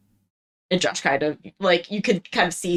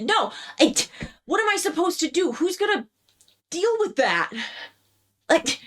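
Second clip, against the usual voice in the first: put-on higher, pleading teen protest; upset protest; 11 semitones higher than usual. Son protesting to his police officer father, voice climbing in distress. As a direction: push back at the officer, voice rising.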